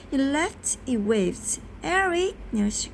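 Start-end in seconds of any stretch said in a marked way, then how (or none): 1.8-2.3 high narrated squeak. A narrator's reading. Neil calling out to Eric, high squeak. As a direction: call the name in a raised, squeaky pitch.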